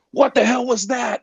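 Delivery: high-pitched mock outrage; sudden high, indignant-sounding demand; retold mad-sounding boss. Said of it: "I thought he was mad".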